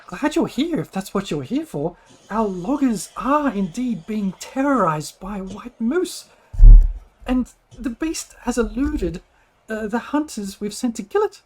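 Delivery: high, swooping village speaker's plea; earnest little woman pleading for help; halfling lady, worried. Acted. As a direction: explain the danger in a high halfling voice.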